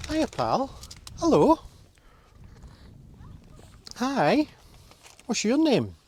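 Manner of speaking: high, loud sing-song for a dog. Real, not acted. Greeting a dog on the summit.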